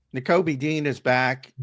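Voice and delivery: ordinary delivery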